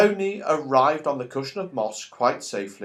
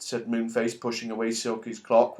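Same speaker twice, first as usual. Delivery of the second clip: plain reading voice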